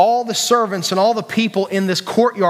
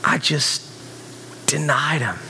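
slow, stunned whisper of regret; hushed, broken-up admission of betrayal; shame and guilt; 3 semitones lower, 6 dB quieter, slower than usual. Acted. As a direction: whisper it haltingly, as a guilty confession. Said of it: "The instant shame and guilt".

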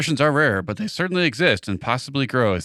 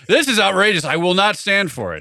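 mock outrage; 6 semitones higher than usual. Acting indignant zoo dad.

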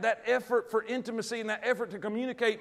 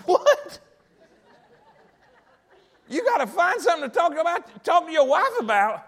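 very high, loud, hammed-up "what" and question; astonished disbelief; 7 semitones higher, 7 dB louder than usual. Man astonished his friend needs topics for his wife, loud disbelief. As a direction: squawk it in acted-out amazement.

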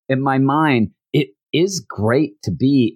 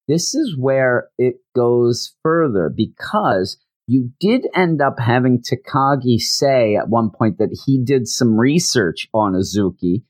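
normal talking voice

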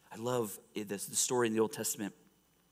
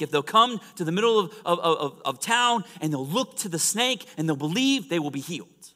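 loud, raised, quick commands; urgent preached instructions; 6 semitones higher, 8 dB louder, faster than usual. Acted. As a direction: hand down instructions as God, preaching hard. Preacher voices God's instructions.

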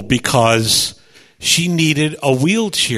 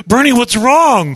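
worried boss; 8 semitones higher, 5 dB louder than usual. Retold or imitated. Boss's worried question retold.

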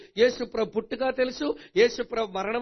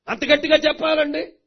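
imitated complaint; 6 semitones higher, 8 dB louder than usual. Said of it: "అంటారు".

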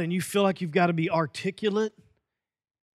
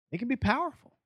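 usual speaking voice

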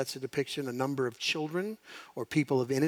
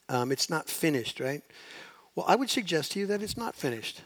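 ordinary talk

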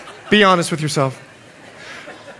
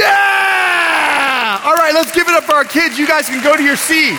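whoop, then call for applause; excited cheer; 10 semitones higher than usual. Real, not acted. Preacher applauding the volunteers, excited hype.

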